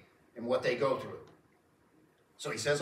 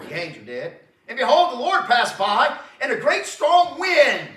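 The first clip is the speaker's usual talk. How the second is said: much higher, louder, slow scripture proclamation; preacher hammering out the storm verses; full-force preaching; 9 semitones higher, 12 dB louder, slower than usual. Acted. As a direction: thunder the verses at the congregation.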